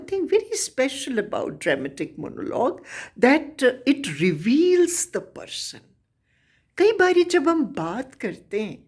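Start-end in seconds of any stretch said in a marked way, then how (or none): none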